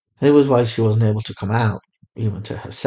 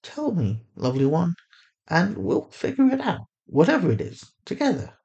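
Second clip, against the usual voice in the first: gentle; 8 semitones higher than usual. A narrator's reading. A mother gently coaxing her frightened daughter to talk. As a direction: coax it out of her tenderly. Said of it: "her tone remained gentle".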